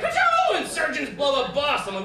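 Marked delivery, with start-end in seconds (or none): 0.0-0.6 very high, loud laser zap; laser sound effect. Retold or imitated. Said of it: "like, the laser sound effects". 0.6-1.9 loud, high mock headline; alarmist news anchor. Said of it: "And they'll be like".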